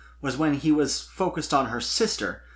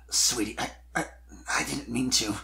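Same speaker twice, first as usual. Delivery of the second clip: faltering apology; 4 dB quieter than usual. A narrator's reading. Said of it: "he began".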